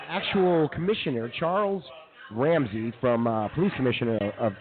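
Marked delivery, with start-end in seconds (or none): none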